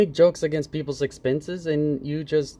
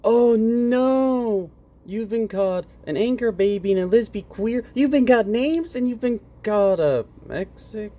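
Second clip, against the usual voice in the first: mock sympathy; 8 semitones higher than usual. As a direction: fake gasp of concern, dripping sarcasm. Mocking Carlos's complaint.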